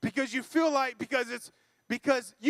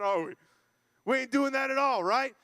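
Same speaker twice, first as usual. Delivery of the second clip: ordinary talk, nothing marked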